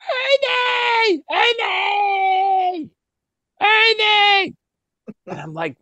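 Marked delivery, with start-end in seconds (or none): 0.0-4.5 very high, drawn-out shriek of the name; high-pitched imitation of a screaming friend; Ernie's scream. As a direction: screech it out like a lost little guy. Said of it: "I hear someone screaming".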